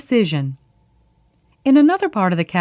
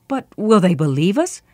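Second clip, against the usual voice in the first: plain reading voice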